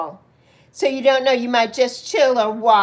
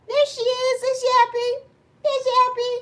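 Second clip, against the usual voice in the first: high cooing; 11 semitones higher, faster than usual. Real, not acted. Cooing to a viewer.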